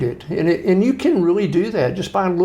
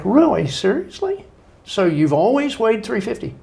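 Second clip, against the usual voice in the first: higher, teasing incredulous questions; mock disbelief; 6 semitones higher than usual. Ribbing an out-of-shape friend with mock disbelief. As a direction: pretend to be amazed at the excuse. Mocking friend's weight excuse.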